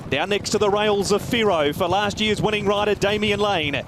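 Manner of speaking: ordinary talk, nothing marked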